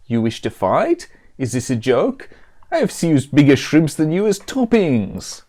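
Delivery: mock Italian, straying vaguely Mexican; Italian accent. Pizza elemental scoffs at the hero's challenge, in mock Italian accent. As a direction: taunt in a badly faked Italian accent. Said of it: "stop using this accent".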